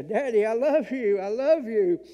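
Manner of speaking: desperate shout rising to a near scream; screaming love. Retold or imitated. A son screaming from the truck window to his dying dad, desperate full-voice shout. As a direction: cry it out as loud as you can. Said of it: "I was screaming down there".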